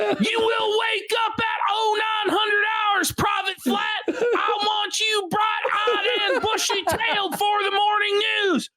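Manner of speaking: high barking drill sergeant. Retold or imitated. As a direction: shout orders like a drill sergeant. Mock drill sergeant order.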